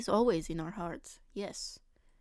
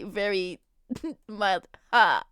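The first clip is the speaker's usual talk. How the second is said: laughing; 4 dB louder, slower than usual. Real, not acted. Laughs while describing the bit.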